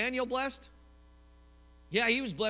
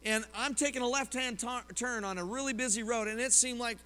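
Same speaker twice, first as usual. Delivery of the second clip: ordinary talk, nothing marked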